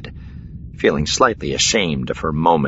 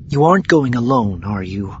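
higher-pitched, falling in a sigh; sighed question; 7 semitones higher, 6 dB louder than usual. A narrator's reading. Wife asking Han about going alone, sighed. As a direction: let the worried question sink as it goes. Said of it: "she sighed".

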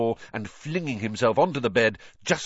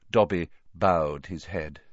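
ordinary delivery

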